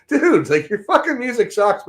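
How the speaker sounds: high, half-laughing retort; laughing retelling. Retold or imitated. Retelling the bus stop put-down, laughing. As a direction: tell him off through a laugh.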